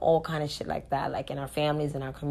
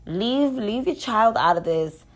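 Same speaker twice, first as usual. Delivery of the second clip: loud, raised-voice scolding; angry demands; 3 semitones higher, 5 dB louder than usual. Real, not acted. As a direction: pile up demands, loud and insistent. Angry demands to leave women alone.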